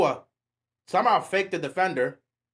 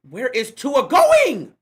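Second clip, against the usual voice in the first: loud and slow, higher, stretching the question; real disbelief, louder and drawn out; exasperated shout; 6 semitones higher, 9 dB louder, much slower than usual. Real, not acted. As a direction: demand an answer from the screen. Fan shouting at the quarterback.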